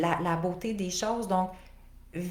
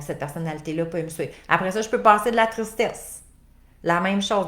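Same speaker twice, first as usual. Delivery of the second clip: ordinary talk, nothing marked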